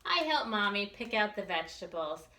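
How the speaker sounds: ordinary delivery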